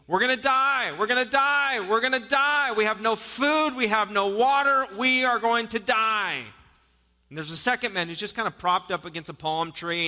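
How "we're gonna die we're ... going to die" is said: high, loud panicked cries of doom; castaway screaming in panic at his calm friend. Acted. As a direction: wail it like a man sure he'll die. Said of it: "one of them begins screaming".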